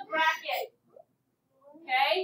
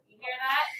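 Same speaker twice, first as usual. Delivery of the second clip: ordinary delivery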